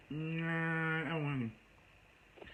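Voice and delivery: long drawn-out "nah"; imitated reluctant refusal. Caller refusing to give a name, imitated reluctance. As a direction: drag out the refusal slowly.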